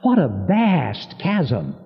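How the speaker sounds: ordinary talk, nothing marked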